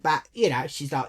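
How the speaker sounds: usual speaking voice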